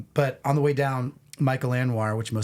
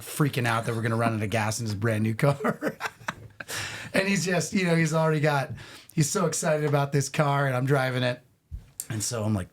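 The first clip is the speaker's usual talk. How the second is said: ordinary delivery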